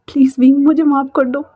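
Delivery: high, broken, stammering sobbing plea; tearful pleading. Acted. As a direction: beg forgiveness through tears, catching on repeated words. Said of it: "वांग जी रोते रोते बोल रहा".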